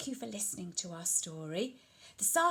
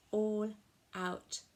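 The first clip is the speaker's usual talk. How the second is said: usual speaking voice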